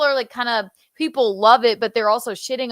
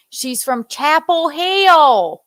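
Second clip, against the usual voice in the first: higher, drawn-out Chapel Hill twang; Chapel Hill accent; 5 semitones higher, 4 dB louder, slower than usual. Acted. Fan imitating an actress's Southern character, Chapel Hill accent. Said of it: "And she's doing the accent".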